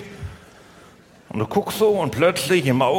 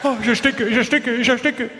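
higher, gasping mock panic; hammed-up fear of choking; 6 semitones higher than usual. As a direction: play someone choking on peanut butter, half in fun. Acting out someone choking on peanut butter.

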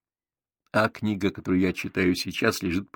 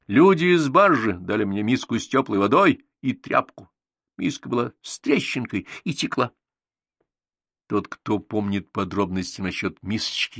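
ordinary delivery, nothing marked

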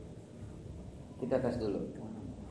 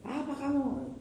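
sharp, much higher mock snap; snapping at child; 11 semitones higher, slower than usual. Preacher shows how not to scold a child, mock snap. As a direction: mimic a parent barking at a kid. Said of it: "Jangan bentak dia".

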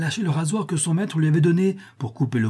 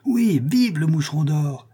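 echoed cheer; 4 semitones higher than usual. A narrator's reading. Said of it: "répétèrent plusieurs voix".

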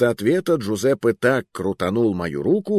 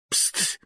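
sharp voiceless psst; hissed psst; 6 dB quieter than usual. A narrator's reading. Hissing to draw his companion's attention, unvoiced. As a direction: hiss a quick signal for attention. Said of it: "шикнул Муруа".

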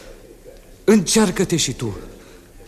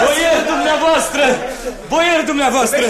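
strigare; 10 semitones higher, 4 dB louder than usual. Acted. Împăratul cheamă slugile.

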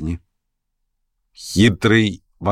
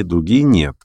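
usual speaking voice

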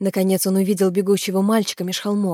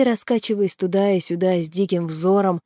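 ordinary delivery

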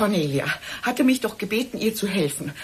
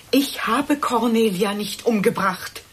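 ordinary talk

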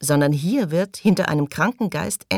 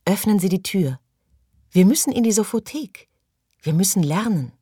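eager urging; 5 semitones higher than usual. A narrator's reading. Maggie presses Morley to open the door, eager. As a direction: urge him, rapt and eager.

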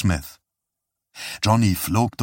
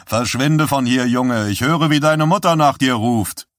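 teasing brush-off; 4 semitones higher, 4 dB louder than usual. Acted. Man shooing off grinning child.

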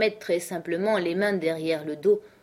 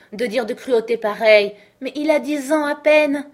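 higher and louder, protesting hard; outraged outcry; 6 semitones higher, 7 dB louder than usual. Acted. Housekeeper protests to the governess, outraged, higher and louder. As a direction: cry it out, appalled at the cruelty. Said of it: "s'écria Missis Groves".

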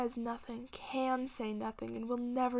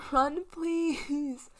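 louder, desperate plea; pleading shout; 4 semitones higher, 7 dB louder than usual. Acted. Grandmother begging Green to run, shouting. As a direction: beg him to run.

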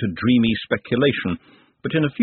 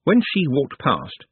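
usual speaking voice

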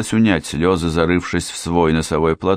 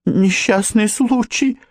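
very high, slow stammer; frightened babble; slower than usual. A narrator's reading. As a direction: falter it out in a tiny high pitch. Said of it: "пролепетала Джанет". (испуганный высокий лепет)

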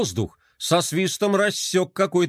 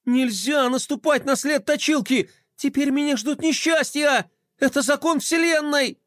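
high-pitched panicked lament; panicking; 11 semitones higher than usual. A narrator's reading. Boy sure misfortune now awaits him, panicking. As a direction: wail as if doom is certain. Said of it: "Флай сразу запаниковал".

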